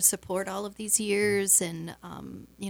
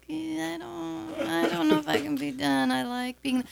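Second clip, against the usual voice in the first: reluctant protest; 8 semitones higher than usual. Retold or imitated. Organizer playfully balking at being done after twenty years, reluctant protest. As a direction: drag it out high, reluctant to give in. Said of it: "But I'm like".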